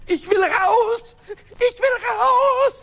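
high, loud, slow wail; trapped imp shouting, high and drawn out; desperate shout. Acted. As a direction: yell to be let out, dragging each word. Pumuckl locked in dark drawer.

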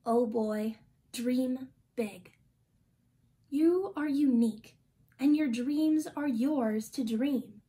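usual speaking voice

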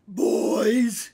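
loud wheezy call; wheezing old man. A narrator's reading. Old man in bed to his gathered friends, wheezing. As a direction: wheeze it out to the whole room. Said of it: "he wheezed".

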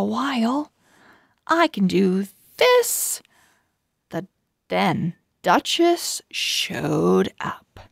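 bright storybook reading with lively pauses; reading to children. A narrator's reading. Host reading a chapter to young viewers, storybook narration. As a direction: read it like a picture book for little listeners.

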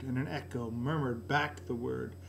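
plain reading voice

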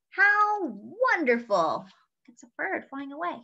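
plain reading voice

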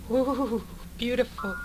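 high breathy gasp of awe; awed gasp. Real, not acted. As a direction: gasp at something beautiful. Admiring the explosion.

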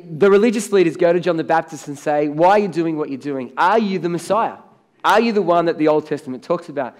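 usual speaking voice

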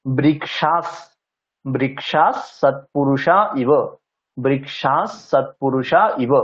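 slow demo, বৃক্ষাস ending in a clear s sound; visarga as s. Shown as a demonstration. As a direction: say বৃক্ষাস with the visarga turned into s, slowly. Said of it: "বিসর্গের জায়গায় যদি দন্তস্ব হসন্তা লিখি". (বিসর্গকে স উচ্চারণ করে ধীরে বৃক্ষাস)

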